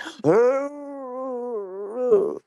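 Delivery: high gasping whimper like the crying dog; imitating dog's crying. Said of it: "He was like".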